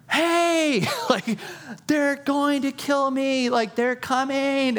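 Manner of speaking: very high, slow drawn-out panicky plea; frantic Peter. Retold or imitated. Peter stuck outside while they debate, high slow wail. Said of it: "Peter's going".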